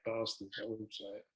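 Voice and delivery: muttered quietly under breath; half-swallowed, quiet curse. Real, not acted. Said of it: "'Cause I'm saying it under my breath".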